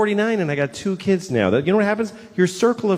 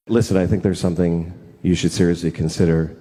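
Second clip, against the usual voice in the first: low, grave doctor voice; a hint of a solemn vet; serious vet; 10 semitones lower than usual. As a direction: deliver bad news like a vet. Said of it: "and the vet said".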